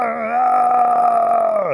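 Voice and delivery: very long, high, loud held howl; hammed-up bawling cry, held long; mock crying wail. A narrator's reading. Rabbit pretending agony in the sticker bushes, wailing loudly. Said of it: "him go out there crying".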